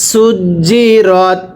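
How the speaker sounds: সুজি রত said very slowly with a firm জ; slow drilled reading. Shown as a demonstration. Teacher drills সুজি রত slowly for students. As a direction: drag out সুজি রত, hardening the জিম. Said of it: "আবার".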